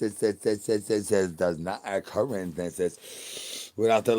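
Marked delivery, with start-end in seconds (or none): none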